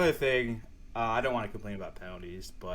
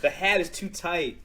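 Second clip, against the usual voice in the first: laughing; 8 semitones higher, 6 dB louder than usual. Real, not acted. Laughing at own hair mishap.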